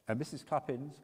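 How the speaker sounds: ordinary delivery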